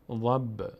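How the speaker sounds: ضب with an echoing release of the b; brief pop after ضب, not a full vowel; qalqala on ضب. Shown as a demonstration. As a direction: bounce lightly off the final b of ضب.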